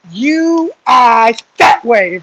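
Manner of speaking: loud, drawn-out command; slow sergeant's order. Retold or imitated. Sergeant directing a recruit's eyes forward, slow loud command. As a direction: spell out the order for someone who doesn't understand.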